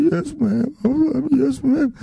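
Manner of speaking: put-on high, timid child's voice; scared little boy. Little boy answering the house mother, high and timid. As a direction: answer meekly like a frightened kid.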